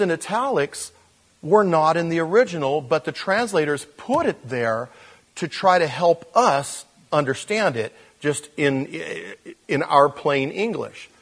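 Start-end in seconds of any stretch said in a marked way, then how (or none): none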